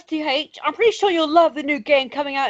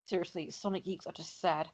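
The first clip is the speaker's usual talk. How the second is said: ordinary talk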